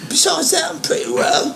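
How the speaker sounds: very high airy squeak; squeaky Sam. Retold or imitated. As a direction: squeak it out in falsetto. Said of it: "voicing Sam".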